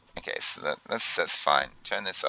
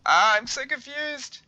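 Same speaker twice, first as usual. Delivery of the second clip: much higher, slow, whimpering squeak; whiny confused squeak; slower than usual. Pretend. Player whining at his own broken base, squeaky whimper. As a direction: whine it out in a tiny high voice.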